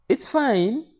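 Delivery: high, loud official voice; high-pitched official pronouncement; lady clerk. Retold or imitated. Lady clerk's verdict, retold.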